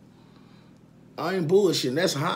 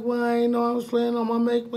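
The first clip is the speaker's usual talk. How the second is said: higher, lightly voiced little girl excuse; a hint of a small daughter explaining herself; mock child voice; 9 semitones higher than usual. Father voicing his little daughter's excuse, lightly higher.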